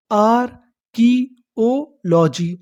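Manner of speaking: Archaeology drawn out slowly as आर-की-ओ-लॉजी; syllable by syllable. Shown as a demonstration. Teacher building the full word for learners, slow syllable by syllable. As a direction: separate the parts of archaeology with pauses. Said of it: "हमारी पूरी meaning क्या बनी".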